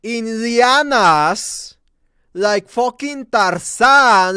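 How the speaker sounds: ordinary talk, nothing marked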